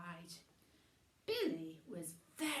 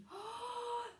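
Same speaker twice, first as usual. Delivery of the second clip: gasp; 13 semitones higher than usual. Acted. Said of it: "absolutely terrified".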